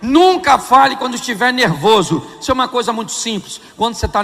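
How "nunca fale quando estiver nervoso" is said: loud rule. Real, not acted. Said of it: "Repita comigo".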